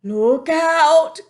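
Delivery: high, loud warning; alarmed cry. A narrator's reading. Giant warns Sophie of the Bloodbottler. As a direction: warn in a panic. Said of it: "cried the B F G".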